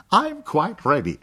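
high, loud cheerful English; bright young painter; English girl. Acted. (junge Engländerin, hoch und laut)

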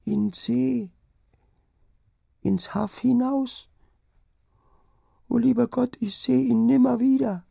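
very high, wailing lament; tearful, as if weeping. A narrator's reading. Delirious wife fearing she has lost her husband, tearful. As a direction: wail it high, drawing out the words. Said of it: "es klang, als ob sie weine".

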